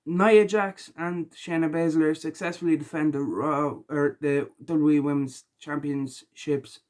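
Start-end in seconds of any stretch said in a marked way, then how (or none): none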